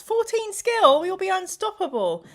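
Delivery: high, loud boast; excited. Real, not acted. Bragging to the chat about fourteen skill, high and excited. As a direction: crow about the new skill. Gloating over new skill.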